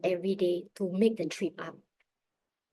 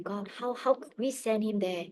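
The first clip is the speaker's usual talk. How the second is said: downcast; 7 dB quieter than usual. Retold or imitated. Mother asks God how she could send her son, downcast. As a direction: plead quietly, as if praying while down. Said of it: "I was down".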